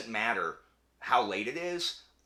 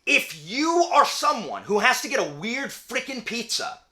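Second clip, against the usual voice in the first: much higher and louder, insistent; passionate rant; 12 semitones higher, 9 dB louder than usual. Real, not acted. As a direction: lecture the weird topping eaters hotly. Mock rant against pizza toppings.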